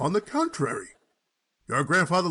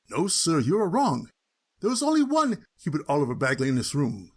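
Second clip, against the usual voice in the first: usual speaking voice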